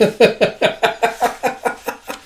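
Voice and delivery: high, rapid chuckling burst; helpless high laughter; real laughing fit. Real, not acted. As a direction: crack up at the joke. Storyteller laughs at the scene.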